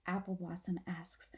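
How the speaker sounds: ordinary delivery, nothing marked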